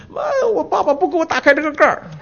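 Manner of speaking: put-on high, childish tearful voice; crying little boy. Little boy telling mother why he cries, high childish voice. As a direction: sound like a small boy catching his breath.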